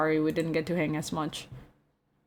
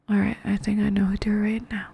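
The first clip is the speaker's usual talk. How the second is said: hushed whisper. Real, not acted. Whispered aside.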